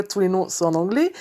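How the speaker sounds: ordinary delivery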